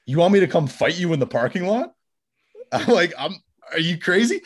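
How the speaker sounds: high, loud, re-enacted disbelief; incredulous. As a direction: question it as if he's lost his mind. Incredulous reply to a fight challenge.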